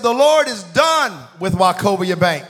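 booming re-enacted prophecy; prophetic declaration. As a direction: pronounce judgment slowly and forcefully. Imitating preacher's prophetic declaration.

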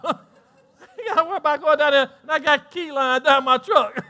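laughing loudly through the words. Real, not acted. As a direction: cackle with relief as you brag. Laughing at his own freedom.

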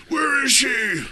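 high gravelly Batman growl. Pretend. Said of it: "That's my Batman impression".

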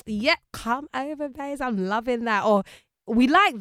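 high-pitched playful approval; hammed-up delight; mock admiration. Imagined admirer of an accent.

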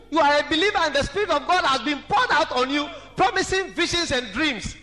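high, loud, fervent sermon climax; full-force preaching. Acted. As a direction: preach it out, rising to a cry.

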